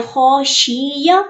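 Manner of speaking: "खौशिया" with a long "शि"; wrongly lengthened middle. Shown as a demonstration. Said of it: "यूं भी नहीं है".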